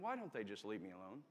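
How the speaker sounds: low, quiet grumble to himself; grumpy private thought, kept low and soft. Retold or imitated. Grumpy patient thinking about the nurse, grumbled low. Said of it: "I wasn't in a good mood".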